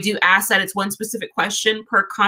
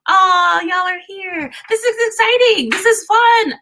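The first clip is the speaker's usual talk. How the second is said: bright burst of real excitement, much higher; excited; 9 semitones higher, 4 dB louder than usual. Real, not acted. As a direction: squeal with joy at the crowd. Excited greeting of live viewers.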